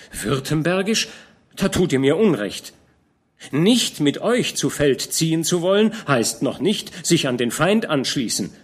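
put-on young man protesting to the old commander; young knight protesting. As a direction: defend your honour eagerly. Georg protests his innocence.

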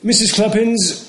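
high, loud courtroom address; pompous prosecutor. A narrator's reading. Prosecutor Buzfuz calls the witness to the stand, pompous and loud. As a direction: call the witness like a pompous lawyer.

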